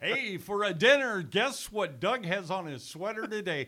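loud, high announcer hype to the listeners; radio host call-out. Retold or imitated. Host on air daring callers, big loud announcer voice. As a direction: pump up the callers like a DJ teaser. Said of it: "open up the phone lines and say".

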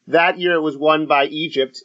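ordinary talk, nothing marked

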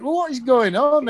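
high-pitched retold bewilderment; mock astonishment. Acts his astonishment at the boy.

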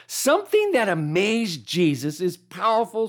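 usual speaking voice

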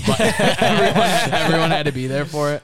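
talking through laughter, high and loud; loud, high-pitched laughing speech. Real, not acted. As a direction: crack up while getting the words out. Amused retelling, laughing through it.

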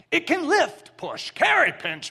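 rising sermon list, loud and high; emphatic preaching list. Acted. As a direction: hammer every action word home. Emphatic list of glove actions.

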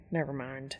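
low, soft mutter under breath. A narrator's reading. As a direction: mutter it to yourself.

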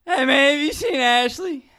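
put-on loud, high drunken holler; drunk Southerner. Drunk passenger to Uber driver, Southern drunken holler. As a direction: call out like a drunk with a Southern accent. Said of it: "I am extremely drunk".

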